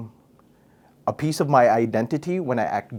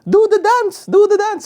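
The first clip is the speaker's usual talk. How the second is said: high, loud mock parent shout; parent calling out; 8 dB louder, slower than usual. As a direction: call the kid out to dance like an excited parent showing him off to the aunties. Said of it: "your mom or your dad would be like".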